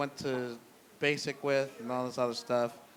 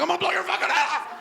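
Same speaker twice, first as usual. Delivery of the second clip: frantic high-pitched rapid yell; mock police shout; 14 semitones higher, 4 dB louder, much faster than usual. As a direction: scream a threat like an overexcited cop. Acts police storming in shouting threats.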